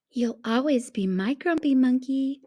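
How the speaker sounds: ordinary delivery, nothing marked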